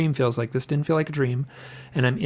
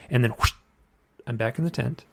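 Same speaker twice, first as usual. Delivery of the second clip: ordinary delivery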